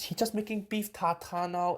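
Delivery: ordinary talk, nothing marked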